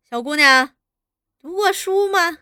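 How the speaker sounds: teasing. A narrator's reading. Interrogator toying with frightened girl, teasing. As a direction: toy with her as if kindly. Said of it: "又用手去逗她".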